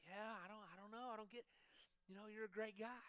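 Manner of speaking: soft, hedging mumble; mock evasive mumble. Dodging a coworker's question with a soft, evasive mumble. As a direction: dodge the question softly.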